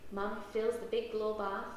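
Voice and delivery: ordinary delivery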